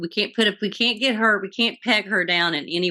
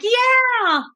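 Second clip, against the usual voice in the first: excited cheer; 4 dB louder than usual. Retold or imitated. Sons cheering their agreement.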